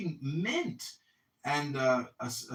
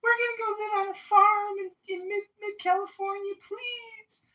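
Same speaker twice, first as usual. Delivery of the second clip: much higher, lightly voiced sweet young couple; mock sweet couple. Mocking the couple who want the RV, much higher and sweet. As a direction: chirp it up high like a starry-eyed couple. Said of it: "the cute couple is like".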